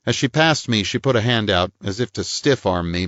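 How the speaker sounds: ordinary talk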